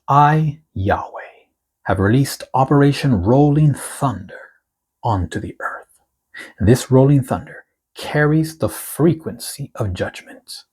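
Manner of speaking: slow, weighty reading of the Lord's words; prophetic proclamation. Acted. Reads the prophetic word aloud.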